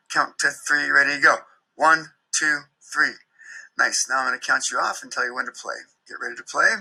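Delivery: a teacher counting the band in over the music. Acted. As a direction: call out the count in time, clear and steady. Recording played back.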